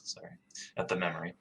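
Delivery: usual speaking voice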